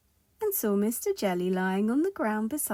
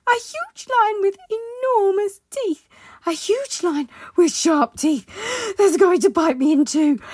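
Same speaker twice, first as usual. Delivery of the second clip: high, loud, hysterical wailing; panicked shriek; 11 semitones higher, 12 dB louder than usual. Acted. Timid Mister Jelly imagines a lion, hysterical shrieking. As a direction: work yourself up into a shrieking frenzy of terror. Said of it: "shrieked Mister Jelly".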